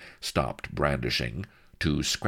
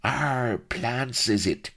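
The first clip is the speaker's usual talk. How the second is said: higher, rustic voice piping up; eager servant's cry; 9 semitones higher, slower than usual. A narrator's reading. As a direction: pipe up as an obliging old retainer. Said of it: "cried Solomon Shafto".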